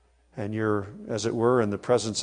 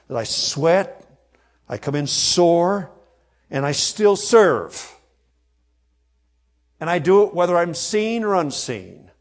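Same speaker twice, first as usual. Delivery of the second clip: rousing preaching; 5 semitones higher, 6 dB louder than usual. Real, not acted. Preacher challenging his church to serve without thanks, loud and fervent. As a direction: drive the point home with fire.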